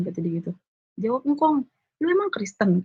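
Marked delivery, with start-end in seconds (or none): 2.0-2.8 high, mock-suspicious old man; grandpa's challenge. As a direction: accuse her lightly in grandpa's voice. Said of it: "kata dia gitu kan".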